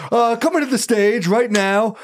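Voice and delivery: grieving owner barking the intro; strained owner. Retold or imitated. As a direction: force out the intro through tension.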